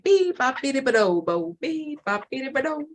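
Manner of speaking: high, chirpy electronic beeps; imitating beeping machine. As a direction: mimic a machine beeping out a tune. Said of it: "It was like".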